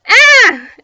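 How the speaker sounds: high, very loud shriek of terror. A narrator's reading. Everyone terrified by the ghost, shrieking. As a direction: shriek in fright. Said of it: "everyone shrieked again".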